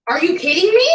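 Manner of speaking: real outrage, hurling the question back; outraged disbelief. Real, not acted.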